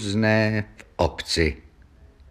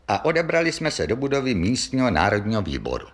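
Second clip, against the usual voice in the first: usual speaking voice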